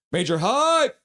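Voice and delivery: high, loud burst of hype; hype cheer. Retold or imitated. Host reading hype from fan chat, cheering. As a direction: cheer it with a soaring last word.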